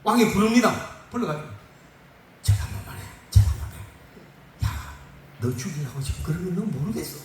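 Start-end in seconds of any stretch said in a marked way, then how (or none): 2.4-7.2 hushed, coaxing royal aside; confidential coaxing. Acted. King privately urging the three friends to bow, hushed coaxing.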